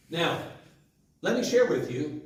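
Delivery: ordinary talk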